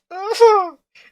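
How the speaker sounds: a high, loud laugh at herself; burst of laughter. Real, not acted. Amused at why she likes spooky reactions, laughing loud. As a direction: laugh out, amused by her own question.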